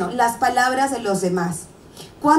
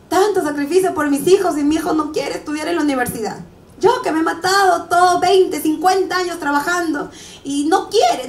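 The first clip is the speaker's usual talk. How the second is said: complaining parent; 5 semitones higher than usual. Retold or imitated.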